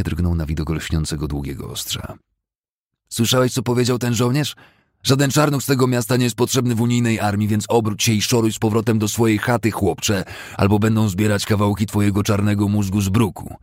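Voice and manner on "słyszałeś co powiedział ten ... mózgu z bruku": loud, higher threatening snarl; menacing threat. Acted. Pimply thug threatening the youth, loud menace. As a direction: threaten him with the blade.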